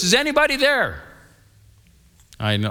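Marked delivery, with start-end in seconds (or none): 0.0-1.0 high call into the woods, raised pitch. Retold or imitated. Pastor calling into thick woods for a missing man. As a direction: call out toward the trees, hoping someone answers. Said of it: "I yell towards the woods".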